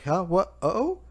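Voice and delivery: rising, startled little huh, what, oh; the voice jumps up in confusion. Retold or imitated. Caller on the snail, caught off guard, startled.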